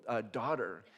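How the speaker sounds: ordinary delivery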